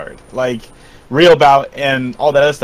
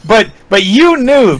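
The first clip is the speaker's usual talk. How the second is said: ordinary talk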